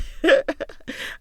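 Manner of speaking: loud breathy laugh. Real, not acted. Laughing at her own muddled phrasing. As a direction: laugh at your own tangled words.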